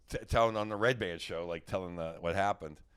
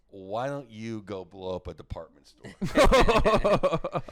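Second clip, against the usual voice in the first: slow snide comeback; 7 dB louder, much slower than usual. Retold or imitated. Comeback to Irish hecklers.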